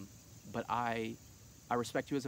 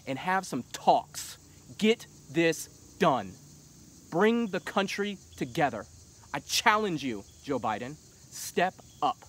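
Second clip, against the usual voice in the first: louder, rising into an impassioned rally cry; heated; 4 semitones higher, 6 dB louder than usual. Real, not acted. Challenging Joe Biden to unite the country, heated and loud. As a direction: passionate challenge, pushing harder with each order. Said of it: "I got all heated again".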